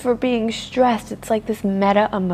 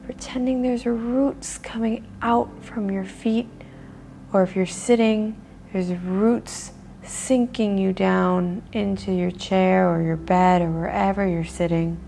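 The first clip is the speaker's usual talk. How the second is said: guided meditation; 4 dB quieter than usual. Acted. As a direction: lull them into stillness. Leads a grounding meditation.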